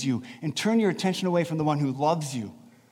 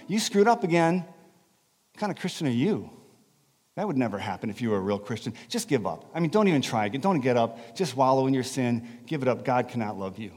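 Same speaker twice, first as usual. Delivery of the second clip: Satan's whisper; 6 semitones lower, 4 dB quieter than usual. Acted. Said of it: "he starts whispering to you".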